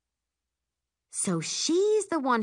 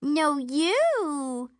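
put-on high, louder cutesy baby talk; 10 semitones higher, 4 dB louder than usual. A narrator's reading. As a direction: coo it back in baby talk. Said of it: "she says in hers".

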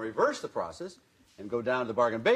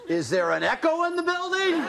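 mock echo. Mocking Al's repeat.

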